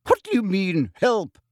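high, tight indignation; constricted voice. A narrator's reading. Professor affronted by a colleague's offer, constricted voice. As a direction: demand it through a tightened throat. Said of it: "in a constricted voice".

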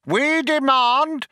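high, slow strident demand; yelling. A narrator's reading. Younger philosopher butts in with a demand, yelling. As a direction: yell it like a picket-line slogan. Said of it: "yelled Vroomfondle".

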